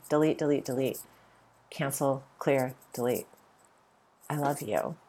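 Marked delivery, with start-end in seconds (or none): none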